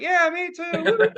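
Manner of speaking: high-pitched playing along; put-on eager agreement; mock enthusiasm. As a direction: echo the strangers' excitement, hammed up. Retold playing along with excited strangers.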